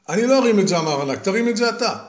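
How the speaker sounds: high, loud and stubborn; stubborn refusal. Acted. Giant refusing to lift the stone, stubbornly insisting. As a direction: refuse and push it back.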